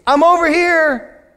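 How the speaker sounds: a hint of a child; small child's reply. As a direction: answer like a small boy calling back. Child's answering voice.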